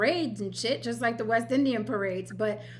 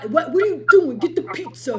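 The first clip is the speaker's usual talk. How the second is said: loud Italian-American caricature; mock Italian-American; 5 semitones higher, 8 dB louder than usual. Host retells an Italian guy's complaint, mock Italian-American. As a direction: ham up an Italian-American accent. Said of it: "Why are you speaking that way?".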